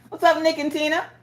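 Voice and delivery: high, bright call-out to viewers; excited greeting. Real, not acted. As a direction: sing out a hello to the chat.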